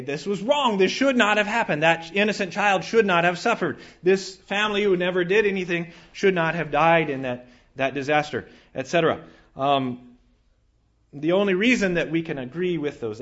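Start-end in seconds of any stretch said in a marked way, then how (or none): 0.0-8.5 higher and louder indignant cry; indignant outcry at needless death; outraged protest. Retold or imitated. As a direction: protest the injustice with force. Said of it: "And we can say".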